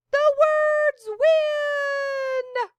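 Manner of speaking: high slow drawn-out victory cry; children shouting. A narrator's reading. Children shout in triumph over the pirates, high and slow. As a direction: cheer it out long and loud. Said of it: "The children shouted".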